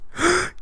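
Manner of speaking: high, exaggerated intake of breath; mock shocked gasp. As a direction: gasp in pretend scandal. Mock shock at host's views.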